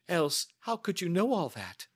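high-pitched, amazed questioning; astonished cry. Acted. Client baffled by the detective's knowledge, crying out in astonishment. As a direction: ask it in startled wonder. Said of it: "she cried".

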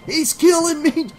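high, loud agonized screams; bellowing in pain. A narrator's reading. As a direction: scream out as you're being attacked. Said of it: "Rob bellowed in pain".

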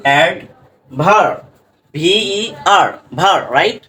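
normal talking voice

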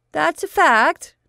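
put-on high, loud and slow boyish demand. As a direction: stretch it out like a cocky boy pressing the point. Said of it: "demanded Otis".

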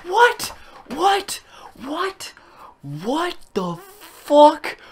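very high, disbelieving squeals; real shock. Real, not acted. Gamer sees the last pack's pull, shocked squealing. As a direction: shriek it, then repeat in stunned high bursts. Stunned by huge final pull.

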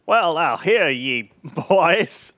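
loud hammed-up frontier drawl; old-timey cowboy. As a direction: hear ye, like a western sheriff.

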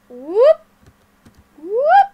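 rising whoops. Real, not acted.

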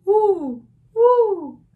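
high, loud owl hoots; owl hooting. Acted. Teacher voicing the owl for children, high loud hooting. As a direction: hoot it twice like an owl. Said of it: "asked the owl".

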